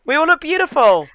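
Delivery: high, loud, gushing anime cheer; Tokyo Mew Mew style. Pretend. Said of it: "that's Tokyo Mew Mew style".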